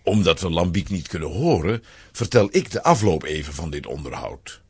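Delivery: normal talking voice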